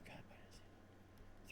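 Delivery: barely audible, slow whisper; whispered aside. Real, not acted. Teacher trying to recall the final solution, whispered aside. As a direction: whisper it to yourself, trying to remember.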